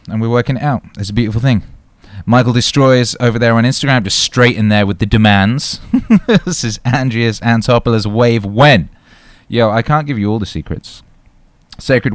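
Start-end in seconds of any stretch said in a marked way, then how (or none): 5.9-6.4 a quick high burst of laughter; short laugh. Real, not acted. Host laughing at a viewer's demands. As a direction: laugh out loud at the chat.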